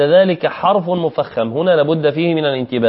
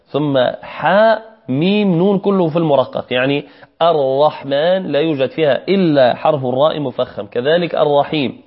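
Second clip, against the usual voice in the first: ordinary talk, nothing marked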